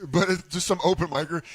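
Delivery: usual speaking voice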